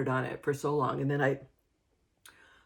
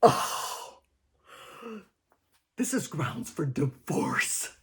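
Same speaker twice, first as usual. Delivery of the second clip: mock dramatic; slower than usual. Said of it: "And I was like".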